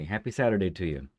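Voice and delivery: ordinary talk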